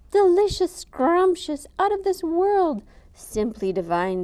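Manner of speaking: loud, high raptures of praise; exclaiming. Acted. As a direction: gush over the food. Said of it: "they exclaimed".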